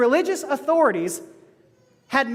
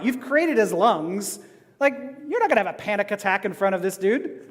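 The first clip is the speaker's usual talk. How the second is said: normal talking voice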